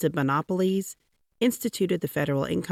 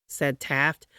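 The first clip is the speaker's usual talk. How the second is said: ordinary delivery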